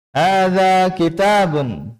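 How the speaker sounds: "Hadza kitabun" high and slow, long vowels held, falling at the end; model statement intonation. Shown as a demonstration. Teacher reads for the class to follow, drawn-out statement intonation. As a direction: stretch "Hadza kitabun" on a high pitch, then drop. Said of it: "Perhatikan panjang pendeknya dan intonasinya".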